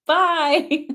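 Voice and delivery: high, loud bye-bye; mocking farewell. Real, not acted. Host dismisses a sceptical viewer, mocking farewell. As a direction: send them off high and loud with a laugh after.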